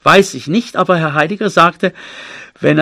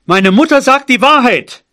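high, loud outburst; overloud cry; 8 semitones higher, 5 dB louder than usual. A narrator's reading. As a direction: blurt it out far too loudly. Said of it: "rief ich überlaut".

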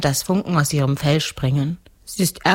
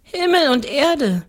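put-on higher child's voice, matter-of-fact; little girl answering; 11 semitones higher, 3 dB louder than usual. A narrator's reading. Girl tells ghost what she ate, simple high answer.

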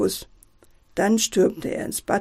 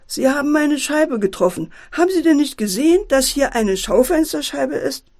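high, raised-voice shopkeeper's complaint; indignant call; 6 semitones higher, 4 dB louder than usual. A narrator's reading. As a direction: call out in protest, higher and pressing. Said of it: "der Friseur rief auch". (empört rufend)

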